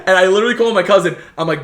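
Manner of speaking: normal talking voice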